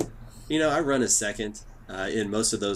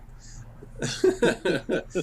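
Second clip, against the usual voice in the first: breathy laugh; 9 semitones higher than usual. Real, not acted. Host laughs at the fake accent.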